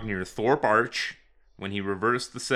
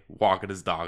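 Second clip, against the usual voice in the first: ordinary delivery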